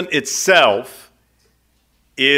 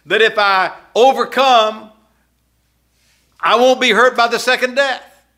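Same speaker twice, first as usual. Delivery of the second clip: lightly voiced puzzled believer, higher pitch; puzzled objector; 6 semitones higher than usual. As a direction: push back as a confused believer, pitched up.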